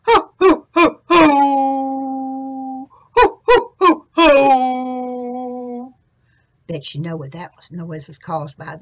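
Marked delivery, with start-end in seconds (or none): 0.1-5.9 loud high baying ho ho; much louder howling hound. Acted. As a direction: howl like an old hound dog. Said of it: "kinda went like this".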